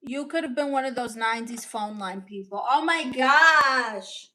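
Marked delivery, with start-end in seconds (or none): 2.7-4.3 surprised. Real, not acted. Reacting to comment.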